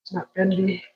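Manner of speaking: ordinary delivery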